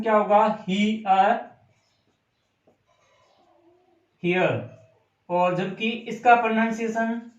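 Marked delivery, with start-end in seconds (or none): 0.7-4.6 He, a, then here, with a long he blended into a; pronunciation split up. Shown as a demonstration. Teacher showing students how here is pronounced, split slowly. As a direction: break here into He and a, then say it whole. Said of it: "इसका pronunciation आप देखेंगे".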